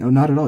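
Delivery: not lifted high and loud; friendly dismissive no; casual reply. Shown as a demonstration. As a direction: toss off "Oh, not at all," brightly. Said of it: "So when you're saying".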